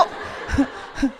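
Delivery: soft airy laugh; quiet real chuckle; breathy laugh. Real, not acted. Laughing at the punchline.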